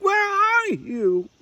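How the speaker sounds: very high, loud, slow desperate calling; searching the dark for her mother; frantic call. A narrator's reading. Little Owl calling for her lost mother, high and desperate. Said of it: "she shrieked".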